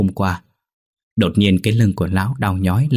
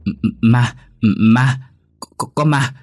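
high, broken stutter of fright; stuttering in fear, pitch raised; terrified stammer; 4 semitones higher, 3 dB louder, slower than usual. A narrator's reading. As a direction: choke on the words, repeating them in panic. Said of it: "miệng lắp bắp".

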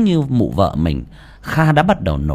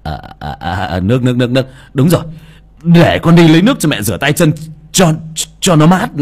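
flustered, repeating nước, rushing then louder; stammering; 3 semitones higher, 6 dB louder, faster than usual. Acted. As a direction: scramble for words in a fluster. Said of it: "Kha lắp bắp".

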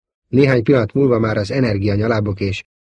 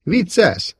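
teasing; 9 semitones higher than usual. A narrator's reading. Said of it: "heccelődve Luke".

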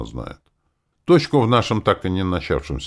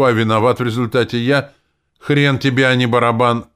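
angry retort; 7 semitones higher, 8 dB louder than usual. Acted. Я разозлился.

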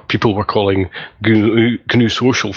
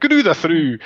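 mock Scottish accent; 8 semitones higher than usual. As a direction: say it like a Scot. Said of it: "It's said in a Scottish accent".

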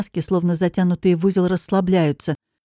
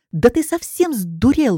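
higher, slower, recoiling cry of disgust; disgusted outburst; 5 semitones higher, slower than usual. Acted. Girl wiping her mouth after a drunk kiss, disgusted outcry. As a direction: spit it out in revulsion. Forcibly kissed, she recoils.

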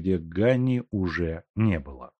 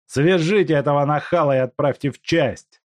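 angry shout; 7 semitones higher, 6 dB louder than usual. Acted. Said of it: "закричал Куликов".

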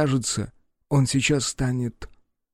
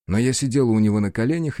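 ordinary delivery

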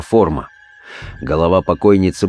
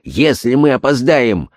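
slow, then climbing ever higher; desperate urgency; 5 semitones higher than usual. Acted. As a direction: rise into a desperate cry. Desperate Holmes, rising. (отчаянно, всё выше)

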